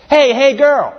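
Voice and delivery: high, casual come-here call of a con man; ludicrous serpent. Acted. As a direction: sell it like a smooth street hustler. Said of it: "it's ludicrous".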